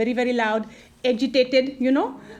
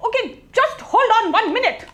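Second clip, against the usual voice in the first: high, raised plea to wait; flustered stalling; 8 semitones higher, 5 dB louder than usual. Acted. Asking an angry caller to wait, flustered and loud. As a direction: cut in loudly to stall them.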